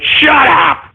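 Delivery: high angry shout; hammed-up rage shout; furious yell. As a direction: snap it out at the screen. Screaming at a viewer's name.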